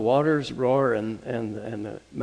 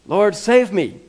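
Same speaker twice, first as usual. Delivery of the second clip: loud cry, pitched up; desperate plea to the Lord; calling out for help; 7 semitones higher, 7 dB louder, slower than usual. Acted. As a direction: cry out as if sinking. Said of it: "He calls out to Jesus".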